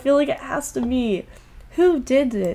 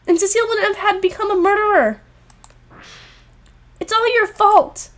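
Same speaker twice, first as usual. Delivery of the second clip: higher, louder, rising fury; angry accusation; 9 semitones higher, 4 dB louder, faster than usual. Acted. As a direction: blame him at full voice. Heroine blames the mastermind.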